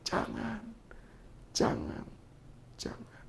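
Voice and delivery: fading whispered plea; a whisper from the conscience, dying away; inner voice whisper. Retold or imitated. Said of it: "ada suara di dalam hati saya berkata". (bisikan hati yang makin lirih)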